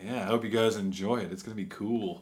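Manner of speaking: low, soft creepy voice. Acted. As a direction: sensual creepy whisper. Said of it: "Why do you sound creepy".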